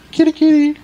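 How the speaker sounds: very high, loud pet-calling voice; kitty calling. Pretend. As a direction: coo for the cat far up in falsetto.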